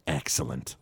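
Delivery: low, soft villain murmur; Burns. Retold or imitated. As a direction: quote a sinister boss, low and hushed. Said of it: "Burns, who has this big suit on".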